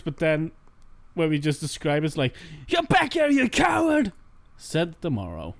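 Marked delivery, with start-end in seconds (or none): 2.7-4.1 overacted shout. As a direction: overact it like a chapter villain. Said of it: "we overacted all the characters".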